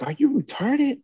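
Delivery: high, shocked disbelief; mock outraged squeal; incredulous retort. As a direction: shoot it up in pitch like you can't believe it. Retold outburst at coworker.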